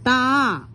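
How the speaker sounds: "താ" with a long, held vowel; drawn-out vowel. Shown as a demonstration. Teacher showing pupils the long vowel of താമര, drawn-out "താ". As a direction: stretch out the vowel in "താ". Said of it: "നീട്ടി പറയല്ലേ നമ്മള്?".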